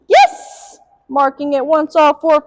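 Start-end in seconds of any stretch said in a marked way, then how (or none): 0.1-0.8 very high, loud bright yes; high marker yes. Real, not acted. Trainer marking the dog's sit, high bright yes. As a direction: praise the dog with a sharp high yes. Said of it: "should be nice and high pitched".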